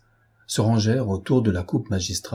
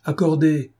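higher, louder group roar of agreement; 7 semitones higher, 3 dB louder than usual. A narrator's reading. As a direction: bellow it like half a mess hall. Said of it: "rugit la moitié du mess".

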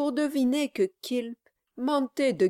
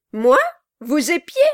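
high, indignant questioning; crying out; 3 semitones higher, 4 dB louder than usual. A narrator's reading. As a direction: throw the accusation back in short bursts. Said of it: "cria Kilp". (exclamation indignée et aiguë)

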